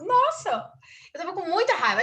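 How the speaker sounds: Nossa jumped very high, then a somewhat high, mildly exasperated voice; mock-shocked at your own anger; exclaiming surprised anger. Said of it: "eu falo". (exclamação surpresa, com a voz bem aguda)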